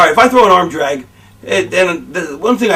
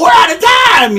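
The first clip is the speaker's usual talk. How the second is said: much higher, louder panicked sign-off; frantic announcer; 4 dB louder, slower than usual. Retold or imitated. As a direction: yelp it as the show cuts off. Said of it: "and they go".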